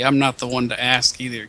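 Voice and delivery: ordinary delivery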